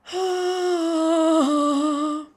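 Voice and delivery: high loud dreamy sigh; swooning sigh. Acted. Swooning sigh after the guy's compliment, high and loud.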